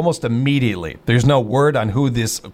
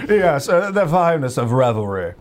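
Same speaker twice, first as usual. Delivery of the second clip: put-on indulgent voice with laughter in it; hammed-up restaurant pitch. Shown as a demonstration. Comic host finishing the restaurant pitch, amused and hammed-up. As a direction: relish it like a pompous epicure. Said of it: "how you're supposed to deliver".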